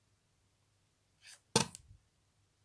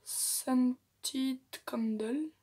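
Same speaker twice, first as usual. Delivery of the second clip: slow, careful English accent on "Scented Candle"; 6 dB louder, much slower than usual. Shown as a demonstration. Reading her eyeshadow name to viewers in a self-mocking English accent. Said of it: "mon accent anglais il va être pourri".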